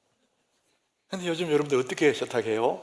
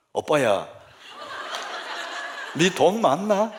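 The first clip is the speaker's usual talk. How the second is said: teasing Gyeongsang dialect line; playful Gyeongsang woman's GPS voice; Gyeongsang woman navigation; slower than usual. Acted. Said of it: "경상도 여자 버전이 있어요".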